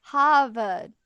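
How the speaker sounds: Boston "Hahvahd", no r sounds; Boston accent. Shown as a demonstration. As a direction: Harvard said "Hahvahd" Boston style. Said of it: "There it is".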